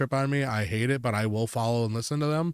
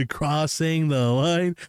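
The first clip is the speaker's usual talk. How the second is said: slow, drawn-out playful echo; exaggerated slow-motion repeat; mock echo; 3 semitones higher, much slower than usual. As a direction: stretch every word teasingly.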